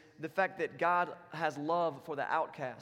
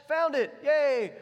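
high, loud, slowly stretched yay; mock celebration; 9 semitones higher, 10 dB louder, much slower than usual. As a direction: play-act a big cheer over a sandwich. Mock celebration of finding sandwich.

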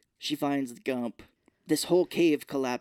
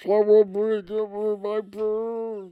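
higher, louder mock distress; hammed-up choking panic; 7 semitones higher, 5 dB louder, much slower than usual. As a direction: gasp it out like a cartoon victim. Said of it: "he's like".

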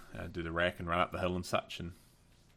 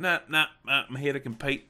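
higher and louder, waving off help; stubborn refusal; 7 semitones higher, 6 dB louder than usual. Retold or imitated. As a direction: insist you're here to compete, louder. Youngster refusing help, determined.

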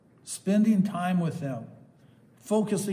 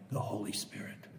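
whispered, much softer; hushed reverence; 7 semitones lower, 12 dB quieter than usual. Real, not acted. Preacher naming the Holy Spirit to listeners in a hushed whisper. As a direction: breathe the name reverently.

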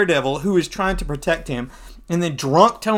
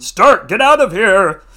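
loud, high urgent yell; shouting order; 5 semitones higher, 11 dB louder than usual. Acted. Hero ordering drunk Stark away, loud shout. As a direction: yell at someone to clear out.

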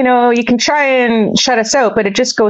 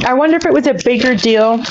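talking while chewing chocolate; words round a bite of candy; mouth full; 4 semitones higher, faster than usual. Real, not acted. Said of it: "I'm also eating".

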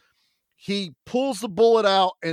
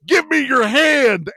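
higher, louder, slow plea; mock heroic plea; 8 semitones higher, 7 dB louder, slower than usual. Old man on the ladder, hammed-up heroic plea. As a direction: reach out dramatically and slowly. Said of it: "the old man turns around and goes".

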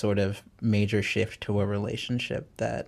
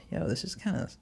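softer, higher, hesitant aside; retold subdued reaction; 6 semitones higher, 8 dB quieter than usual. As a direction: re-enact a quiet, sheepish realisation. Retelling his subdued reaction to songs.

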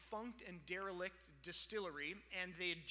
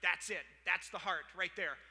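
louder, decisive exclamation; emphatic discovery; 8 dB louder than usual. Acted. Distiller spotting the heart of the run, emphatic. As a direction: call it out as the moment of discovery.

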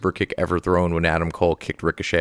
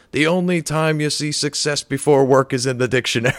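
mock yell; 7 semitones higher than usual. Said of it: "he yells".

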